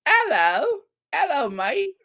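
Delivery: high, playful British "'ello, mate"; hammed-up British greeting, H dropped; mock British accent. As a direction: put on a high-pitched cheeky Brit. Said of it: "you don't use the H".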